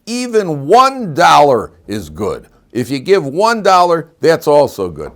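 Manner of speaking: the shul rabbi loudly undercuts the appeal; rabbi's deflating remark. Retold or imitated. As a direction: boom out that even one dollar is fine. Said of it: "and he goes".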